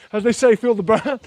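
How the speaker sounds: ordinary delivery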